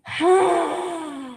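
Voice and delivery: long high audible sigh; dramatic sigh. Shown as a demonstration. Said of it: "大聲放鬆".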